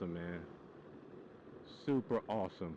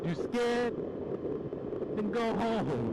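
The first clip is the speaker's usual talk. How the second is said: mock tough taunt; 11 semitones higher, much slower than usual. Teasing the nervous rider.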